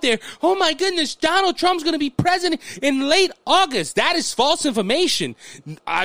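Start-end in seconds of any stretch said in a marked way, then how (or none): 0.4-4.0 high-pitched mock amazement; gushing in mock disbelief, high voice; mock excitement. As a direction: imitate an over-excited believer, pitched up. Mimicking excited believers of rumour.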